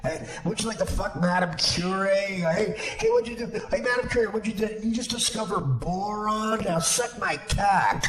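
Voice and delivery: high brash jeering voice; jeering barroom heckle; mock heckler. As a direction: heckle her like a sleazy jock. Mock heckling Madame Curie.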